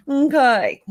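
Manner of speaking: okay through laughter; laughing. Real, not acted. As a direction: say it while cracking up. Laughing fit after remark.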